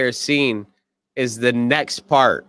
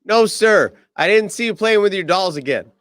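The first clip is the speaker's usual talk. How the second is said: higher, eager denial; mock Colonel Sanders; 6 semitones higher than usual. Colonel Sanders assuring Dark Helmet he saw nothing, higher. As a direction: blurt it out pitched up.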